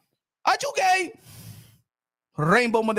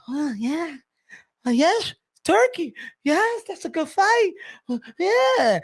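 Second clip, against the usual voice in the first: mock fawning media; 2 semitones higher, slower than usual. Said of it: "y'all be like".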